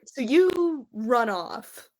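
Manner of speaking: ordinary talk, nothing marked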